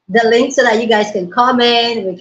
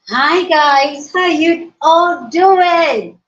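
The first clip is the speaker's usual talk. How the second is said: excited greeting; 6 semitones higher, much slower than usual. Real, not acted. Excited livestream greeting to viewers.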